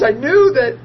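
ordinary delivery

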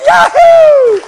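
breathy laugh bursting into a very high, loud yahoo; laugh, then let out a shrieking cheer; joyful whoop. Real, not acted. Preacher celebrates the kingdom inside him, ecstatic laughing whoop.

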